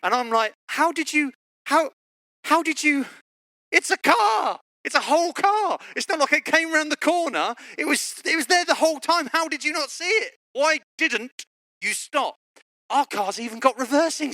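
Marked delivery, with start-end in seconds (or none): 0.7-12.3 high-pitched, stammering disbelief, re-enacted; incredulous outburst. Husband questioning wife about the crash, incredulous. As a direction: splutter it high, hammed-up exasperation.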